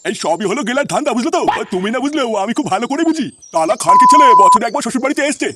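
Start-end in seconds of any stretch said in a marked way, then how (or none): 3.5-5.5 louder, sing-song complaint; angry rant. Acted. Father-in-law ranting about the son-in-law to his wife, loud. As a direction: rail at him in a loud chant.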